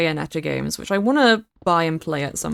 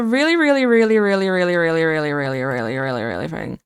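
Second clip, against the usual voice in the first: trailing really; 4 semitones lower than usual. Real, not acted. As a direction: let each really drop down and fade. Rambling repeated really, fading.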